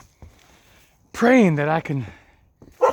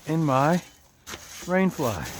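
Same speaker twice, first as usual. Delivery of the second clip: usual speaking voice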